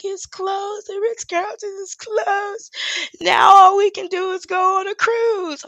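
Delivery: high, slow, mock-tearful whine; daughter's whiny complaint. Mocking the daughter crying over the closed resort, high whiny voice. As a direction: whine it like a spoiled kid losing her resort. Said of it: "She was like".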